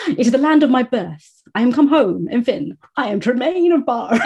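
loud, high, swelling proclamation; grand theatrical declaration. Acted. Co-host quoting the old gentleman's homecoming, pompously declaimed. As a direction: declaim it like a returning hero.

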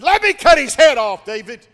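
shouted high and loud, begging to kill; eager shout. Acted. Abishai shouting to David, eager to kill. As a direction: bellow it like a hothead itching to swing the sword. Hitman begs to kill Shimei.

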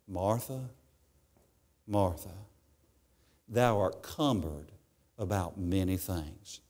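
calm her down tenderly; gentle Jesus. Retold or imitated. Jesus gently answering Martha.

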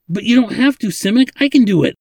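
high, loud, eager plea. A narrator's reading. Ladin tries to soothe the old man, eager. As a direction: jump in eagerly, voice lifted. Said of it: "Ladin said eagerly".

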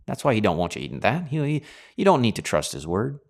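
ordinary delivery

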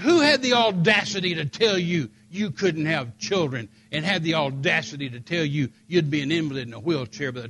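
loud, emphatic indignation; retold outrage. Fiancé outraged at whoever told her that, loud indignation. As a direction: hammer the stressed words in outrage. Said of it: "I was mad at whoever said it".

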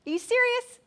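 high, loud disbelief; astonished friend scoffing at the unbeliever; incredulous Shug. Retold or imitated. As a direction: shoot up in shocked disbelief. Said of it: "And Shug says".

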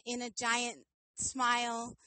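ordinary talk

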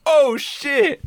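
high loud startled cry; shocked yelp. Retold or imitated. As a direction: blurt it out in shock. Mom's shocked reaction quoted.

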